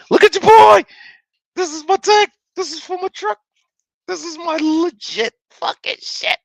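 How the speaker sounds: high-pitched, giddy showing off; very excited. Real, not acted. Streamer showing Matty the new truck tag, very excited. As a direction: squeal it with pride, way up high. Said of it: "He's excited, Matty".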